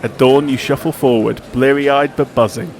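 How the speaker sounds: dramatic scripted intro read over music; documentary narration. Acted. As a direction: read it like a sports documentary voiceover. Scripted podcast cold open.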